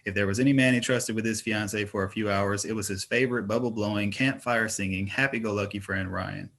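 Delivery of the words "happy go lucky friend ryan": plain reading voice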